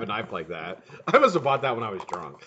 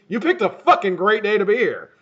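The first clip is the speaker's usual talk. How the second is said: strained voice; 7 semitones higher, 7 dB louder than usual. Real, not acted. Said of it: "Why does my voice sound like that?".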